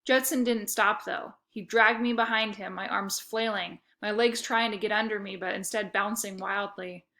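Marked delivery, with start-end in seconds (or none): none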